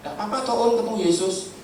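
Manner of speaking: high-pitched, offhand comforting of a patient; breezy consolation. Retold or imitated. Teasing comfort to crying patient. (menghibur santai dengan nada tinggi)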